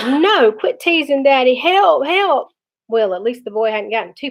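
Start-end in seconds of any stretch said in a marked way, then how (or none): 0.1-2.5 higher, stretched mock-whine; helpless whining. A narrator's reading. Stuck brother begs Dad to quit teasing, helpless whining. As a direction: whine and wail for help, pitched up.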